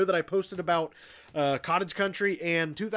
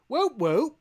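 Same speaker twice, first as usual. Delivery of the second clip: mock British whoop; 8 semitones higher, 3 dB louder than usual. Podcast host testing an Australian cheer, mock British. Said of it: "that sounded more British".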